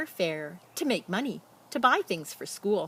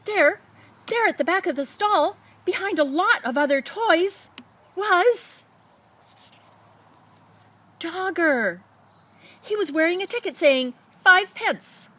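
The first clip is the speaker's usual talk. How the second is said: excited discovery; 10 semitones higher, 6 dB louder than usual. A narrator's reading. Boy suddenly spots lost toy.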